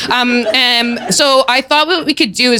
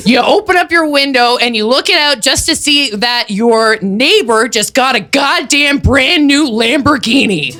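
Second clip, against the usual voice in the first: ordinary talk, nothing marked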